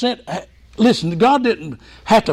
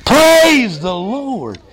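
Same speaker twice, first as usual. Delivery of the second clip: slow, loud, raised-pitch praise; 5 semitones higher, 6 dB louder, much slower than usual. Shown as a demonstration. Preacher getting the congregation to say it together, loud voice. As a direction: lift it up and draw it out loudly. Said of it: "In a, a low voice or a loud voice".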